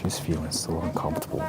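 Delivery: hushed aside; whispering. Real, not acted. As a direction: whisper it under breath.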